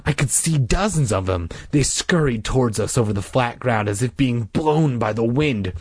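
plain reading voice

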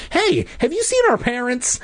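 high, breathless question. Acted. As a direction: ask it out of breath. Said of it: "I asked breathlessly".

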